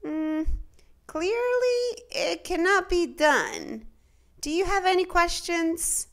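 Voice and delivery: put-on a fussy clerk brushing the kid off; clerk's voice. A narrator's reading. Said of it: "The clerk said".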